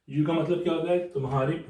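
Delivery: ordinary delivery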